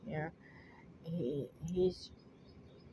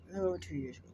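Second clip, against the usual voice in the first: ordinary talk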